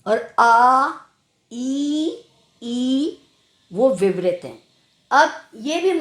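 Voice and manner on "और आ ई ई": long open vowels आ and ई; teacher sounding आ then ई slowly; vowel demo. Shown as a demonstration. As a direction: hold each vowel with the mouth wide. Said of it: "अ जो है वो समृत है".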